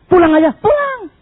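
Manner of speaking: high exclaimed walk-out; mock offended churchgoer; huffy outburst. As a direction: throw it out high, fed up. Acting offended churchgoer storming off.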